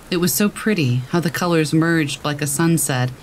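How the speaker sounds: usual speaking voice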